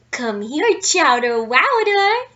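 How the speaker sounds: put-on loud, high baby talk to the dog; sing-song pet name call; doting owners calling. A narrator's reading. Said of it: "they'd said".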